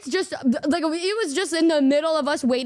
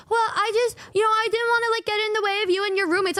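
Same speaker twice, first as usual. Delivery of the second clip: friend's sheepish excuse, pitched up; mock timid friend; 7 semitones higher, much faster than usual. Mimicking a friend's shy excuse, pitched up.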